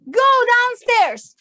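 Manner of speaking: ordinary delivery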